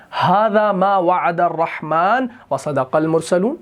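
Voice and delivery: slow chanted tajweed, vowels drawn long; Quran recitation. Shown as a demonstration. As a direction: recite the ayah in a melodic chant. Reciting the ayah.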